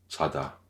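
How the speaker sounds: "Chada" with a light ch and no puff of air; no breath on the "Cha" of "Chada"; light unaspirated ch. Shown as a demonstration. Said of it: "pas de souffle".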